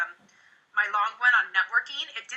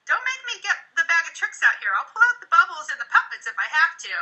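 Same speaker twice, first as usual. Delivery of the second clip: teasing; 6 semitones higher, 3 dB louder than usual. Shown as a demonstration. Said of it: "I will tease a little bit".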